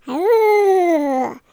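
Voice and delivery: loud, long wailing ghostly ooh; haunt them with a moan. Shown as a demonstration. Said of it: "zombie, or ghost moans".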